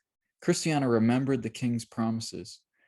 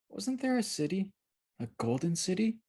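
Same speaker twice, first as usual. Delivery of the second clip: put-on soft, wondering girl; 9 semitones higher, 4 dB quieter than usual. A narrator's reading. Mercy dreamy and unsure.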